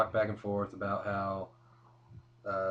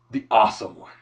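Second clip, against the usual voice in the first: booming ring announcer call; 12 dB louder than usual. Retold or imitated. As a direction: belt it like a wrestling announcer. Announcer-style hype for The Miz.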